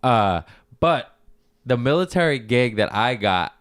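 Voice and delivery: usual speaking voice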